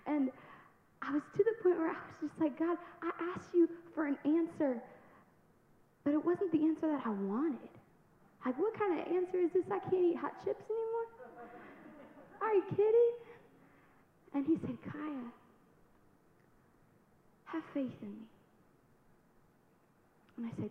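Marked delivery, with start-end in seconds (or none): 2.6-13.1 building from high to much higher and louder; rising indignation toward God; exasperated. Retold or imitated. Demanding answers from God, building exasperation. 14.9-18.3 softer, calm answer in God's voice; a hint of a tender voice of God; gentle reassurance. God comforting Kaya, softly.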